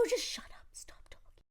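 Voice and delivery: exasperated whisper. Real, not acted. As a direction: whisper at him to shut up. Aside to the character.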